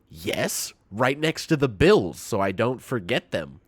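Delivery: loud grandma, totally sure of herself; insistent grandma. Retold or imitated. Grandmother explaining, voiced.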